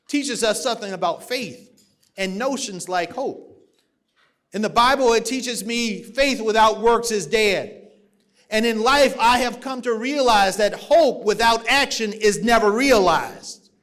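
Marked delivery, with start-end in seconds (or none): 4.5-13.7 loud, rousing rally preaching; preaching at full force. Acted. Party chair rallying the convention crowd, loud rousing preaching. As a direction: lift the whole hall, louder with every line.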